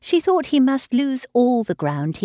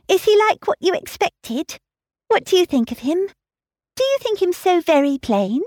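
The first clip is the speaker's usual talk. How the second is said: put-on a higher, breathless girlish voice, all aflutter; eager young Harriet; 6 semitones higher than usual. Harriet to Emma, excited about meeting Mr Martin, fluttering. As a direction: gush it out like a flustered young girl.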